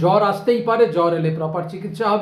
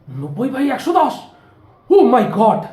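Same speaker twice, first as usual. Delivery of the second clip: mock shock; 4 semitones higher, 4 dB louder than usual. Neighbour shocked by pressure.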